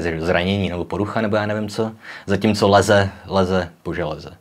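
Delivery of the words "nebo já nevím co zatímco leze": ordinary talk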